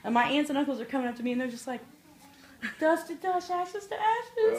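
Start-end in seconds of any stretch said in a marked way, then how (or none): 2.8-4.6 high, drawn-out imitation of mourners; mock tearful. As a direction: recite it like a weepy mourner.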